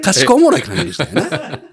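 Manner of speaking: laughing tease. Real, not acted. Teasing mock summary.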